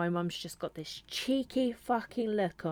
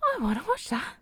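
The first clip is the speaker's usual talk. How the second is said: high, bright mock-listener voice, much higher; eager listener voice; 10 semitones higher, 6 dB louder than usual. Imagined listener deciding to watch the show, eager high voice. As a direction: play an intrigued stranger.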